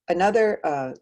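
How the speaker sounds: ordinary talk, nothing marked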